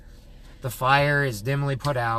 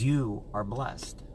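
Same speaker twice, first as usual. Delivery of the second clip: softer and very slow, bestowing a blessing; quiet slow blessing; 3 semitones lower, 6 dB quieter, much slower than usual. Retold or imitated. Stranger blesses the generous host, softly and slowly. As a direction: hush it gently like a benediction.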